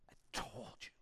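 breathy I-told-you-so whisper. Retold or imitated. A disciple whispering smugly to the others after Judas walks out. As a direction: hiss it like a secret.

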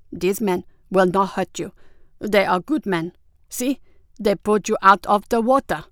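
usual speaking voice

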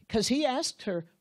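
ordinary delivery

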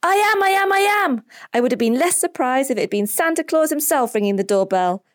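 put-on very high, gleeful child's voice; high-pitched giddy excitement; overjoyed boy. A narrator's reading. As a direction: burst out in delight, then settle a little. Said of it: "laughs Pablo".

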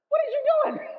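high, loud, shocked squeal at the sleeper; hammed-up disciple shrieking in alarm; shrill panicked cry. Preacher voicing a disciple who shrieks at sleeping Jesus, panicked high squeal.

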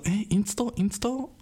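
high, puzzled rising echo; puzzled woman. Retold or imitated. She repeats his odd word, high and confused. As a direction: ask it back, baffled and high. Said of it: "sie war so".

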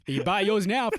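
high, loud sales pitch; infomercial. Pretend. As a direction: hype it like a TV ad. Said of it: "kinda sounded like an infomercial".

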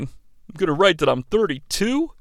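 usual speaking voice

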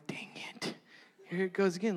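hushed, exasperated whisper; whispered dang it. Retold or imitated. Crying again in class, whispering to himself. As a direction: mutter it under your breath, resigned. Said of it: "I'm like".

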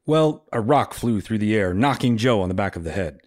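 normal talking voice

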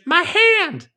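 very high mock cry of pain; exaggerated high pitch. Imitating the villain losing his hand, exaggerated high-pitched cry. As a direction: squeal it, overdoing the impression. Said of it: "it's not that high-pitched".

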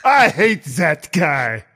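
slow, hammed-up fake French accent; mock French accent, drawn out. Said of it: "Stupid fake French accent".

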